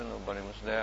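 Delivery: ordinary delivery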